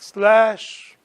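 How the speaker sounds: usual speaking voice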